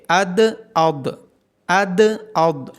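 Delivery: اد thin then اد thick, slow and firm; slow letter drill. Shown as a demonstration. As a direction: contrast light د with heavy ض slowly. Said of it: "دال کو باریکی کے ساتھ ادا کریں گے اور داد کو موٹا".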